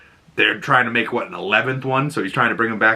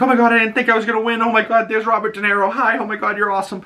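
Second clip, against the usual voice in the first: excited gushing; 11 semitones higher, faster than usual. Retold or imitated. Imitating stunned award winners.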